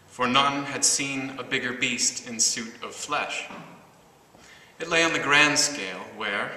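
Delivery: measured, deliberate poem recital with pauses between phrases; projected, carefully articulated verse reading; poetry reading. Acted. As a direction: read the poem out to the room, line by line. Reads own poem aloud.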